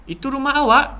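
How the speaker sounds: high, loud, admiring question; impressed amazement. Shown as a demonstration. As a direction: exclaim in awe. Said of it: "perasaan kagum".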